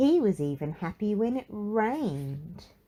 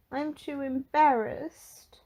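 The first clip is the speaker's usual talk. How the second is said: higher, slow shy reply; Mister Cheerful embarrassed; 8 semitones higher, slower than usual. Acted. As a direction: admit it with bashful hesitance. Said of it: "blushing an even brighter red".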